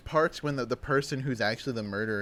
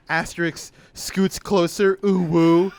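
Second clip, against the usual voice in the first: louder, higher, drawn-out cutesy voice; imitated flirty texter; 5 semitones higher, 7 dB louder, slower than usual.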